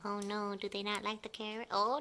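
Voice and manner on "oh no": usual speaking voice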